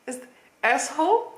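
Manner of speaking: "asshole" tried in a Russian accent, rising like a question; a blooper retake of "asshole" in a Russian accent. Shown as a demonstration. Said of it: "please roast my accent in the comments".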